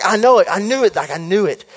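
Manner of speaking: can't contain it; thrilled. Retold or imitated.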